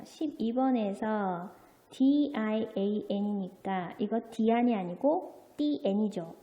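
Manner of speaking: ordinary talk, nothing marked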